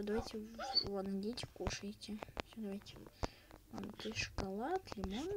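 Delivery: usual speaking voice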